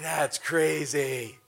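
slow, drawn-out awe; awed disbelief. Retold or imitated. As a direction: marvel at it, word by word. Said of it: "I was like".

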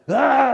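loud, high mock scream of fright; startled yell. Usher imitating his frightened reaction to the demon, startled yell. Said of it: "I went like this".

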